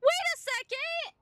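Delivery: high, stunned outburst; can't believe the boss just healed; incredulous. Real, not acted. Player to the stream about the healing dragon, incredulous high outburst. Boss heals mid fight.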